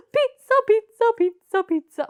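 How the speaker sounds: high-pitched bouncy chant; happy, excited. Acted. Puppet dancing about pizza for dinner, excited chant. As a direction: chant it like a happy little dance. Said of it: "That's a happy, nice dance".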